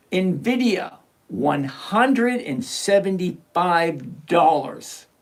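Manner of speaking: slow, spelled-out price for clarity; slow it right down so Darius hears every word. Shown as a demonstration. Repeating the price slowly for a viewer who missed it. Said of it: "I'm gonna say it slower so you can hear me".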